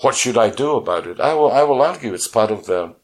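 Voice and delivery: ordinary talk, nothing marked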